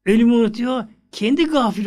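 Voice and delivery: impassioned preacher's cry. Real, not acted. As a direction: cry it out to the congregation. Preacher rising in fervour.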